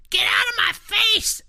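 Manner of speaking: put-on high shrieking toddler yell; toddler screaming. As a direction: scream it like a furious two-year-old. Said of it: "I hear Jenna say".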